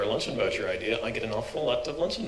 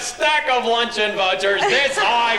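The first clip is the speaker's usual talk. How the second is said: higher and louder, slow, mock outrage; teasing exclamation; 11 semitones higher, 6 dB louder, slower than usual. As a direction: push her playfully, big and loud. Teasing host exclaims loudly.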